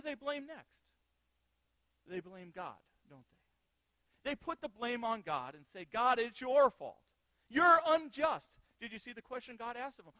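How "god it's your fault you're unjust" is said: loud, slow, indignant accusation; the people of Israel blaming God, slow and loud; accusing complaint. Retold or imitated. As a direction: hurl the blame at God.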